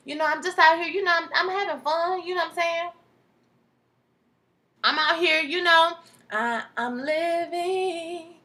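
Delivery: lightly playing the date's casual brag; imitating her voice. Recapping a dating show, imitating the woman's breezy tone. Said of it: "she was saying".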